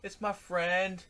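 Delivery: put-on slow, high, loud drunken answer; imitated drunk man. Drunk kayaker explains to the kids, hollering slowly.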